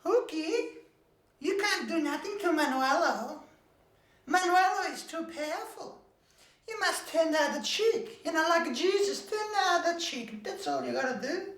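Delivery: high, pleading motherly advice; fretful mother. Acted. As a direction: soothe him in a thin high mother voice. Mother answers her son.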